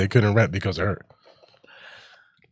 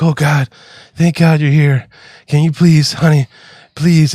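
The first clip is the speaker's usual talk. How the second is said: higher, louder imitation of a needy old lady; pleading old lady; 4 semitones higher, 5 dB louder, slower than usual. Old lady begging her helper, imitated pleading. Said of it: "And she's like".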